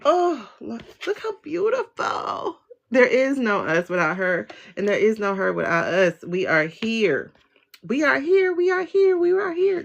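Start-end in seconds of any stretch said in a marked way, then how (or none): 0.0-2.6 high, slow, tender coo; awestruck. Real, not acted. Reader admiring the beautiful cards, awestruck. 6.2-7.3 slow, grounded declaration; slow, solemn. Real, not acted. Reader declaring we are here, solemn. 7.8-9.8 high, urgent repeated chant; tiny Whos calling out. Retold or imitated. Reader playing Horton's Whos, high chanting. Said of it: "This is Horton Hears a Who".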